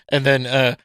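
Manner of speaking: normal talking voice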